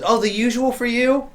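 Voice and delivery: high-pitched eager question; friendly gnome. Acted. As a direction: pitch up for a regular customer. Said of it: "he goes".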